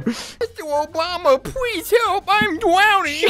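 high, pleading, drawn-out drowning cry; drowning meme voice. Retold or imitated. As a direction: beg like a drowning cartoon cat. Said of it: "You ever seen that meme?".

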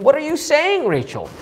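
incredulous husband pushing back; upset outburst. Acted. Jacob to Rachel, incredulous exasperated question. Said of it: "He was upset".

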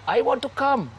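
high, pleading woman's voice; girlfriend's plea. Retold or imitated. Girlfriend begs the gunman to take her, high plea. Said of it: "His bird's going".

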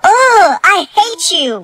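high, drawn-out frustrated outburst; kid's tantrum. Acted. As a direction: whine it angrily at your parent. Son refused game, sent to bed.

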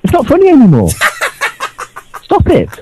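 high, strained protest; exasperated outburst. Acted. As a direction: plead furiously in a high, cracking voice. Mock-fed-up caller ordering show to stop.

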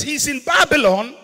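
ordinary talk, nothing marked

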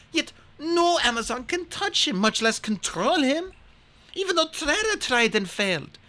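put-on a queen telling an old prophecy; the queen solemnly telling Hazel of the prophecy.

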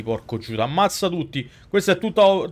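normal talking voice

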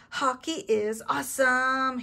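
put-on big, exuberant cheer for young listeners; roaring. A narrator's reading. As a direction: roar it with hammed-up gusto. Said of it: "he roared".

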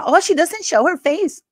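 high, snide mimicry of a critic; mocking quote. Vlogger mocks a critic of a smaller YouTuber, snide high mimic. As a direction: sneer it like the rival YouTuber. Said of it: "criticize the way she ran".

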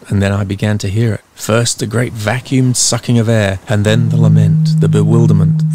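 plain reading voice

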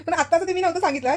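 high, amused protest; giggly teasing insistence; laughing. Real, not acted. Laughing while teasing her husband.

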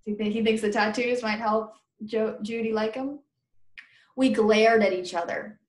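ordinary delivery

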